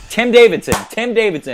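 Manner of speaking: loud, high call-out of the name; yelling a name. Real, not acted. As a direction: shout the name out for everyone to hear. Shouting out the customer's name.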